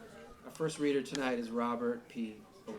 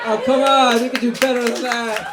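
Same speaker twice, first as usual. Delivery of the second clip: higher and much louder mock complaint; playful loud teasing; 8 semitones higher, 17 dB louder than usual. Real, not acted. Host teases the audience for a weak reply, loud and high. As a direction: goad the crowd for a bigger answer.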